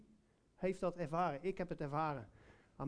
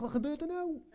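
surprised; 9 semitones higher than usual. Retold or imitated. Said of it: "Ik dacht echt".

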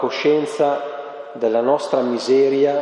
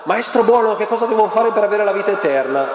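high, sing-song eager flattery; fawning young man; 8 semitones higher than usual. Retold or imitated. The rich young man gushing at Jesus, mock sing-song. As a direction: play up the flattering youth, higher. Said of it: "un po' col culto della personalità".

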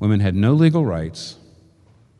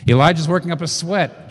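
worked up; 6 semitones higher than usual. Shown as a demonstration. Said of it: "contrast demo".

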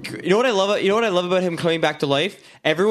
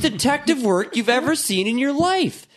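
higher-pitched, incredulous verdict; exasperated ranting; 8 semitones higher than usual. Real, not acted. Ranting to co-hosts about bad detective work, exasperated. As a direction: rant it in high disbelief.

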